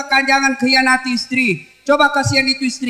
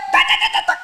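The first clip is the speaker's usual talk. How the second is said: nagging noise; faster than usual. Retold or imitated. Imitating a wife's nagging.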